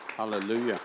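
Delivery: usual speaking voice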